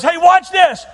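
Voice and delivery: high, loud showman's shout; gleeful shout before the trick; excited shout. Acted. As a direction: shout it like the big reveal. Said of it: "he goes".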